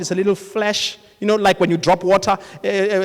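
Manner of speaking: ordinary talk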